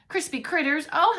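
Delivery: plain reading voice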